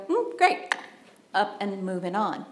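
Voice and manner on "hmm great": breezy skim. Pretend.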